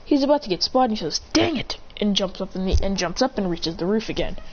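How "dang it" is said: high, sharp exclamation; startled curse. Acted. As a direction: caught off guard. About to get spotted.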